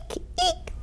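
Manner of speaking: tiny, soft, very high squeaks; mouse squeak. A narrator's reading. Mice scampering in the stable, soft high squeaks. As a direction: squeak softly like a little mouse. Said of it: "squeaked the scampering mice".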